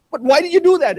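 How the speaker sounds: high, baffled questioning; shocked question. Retold or imitated. Shocked professor questioning the student, pitched high. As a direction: ask it high in disbelief. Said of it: "And I said".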